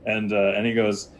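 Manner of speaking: ordinary talk, nothing marked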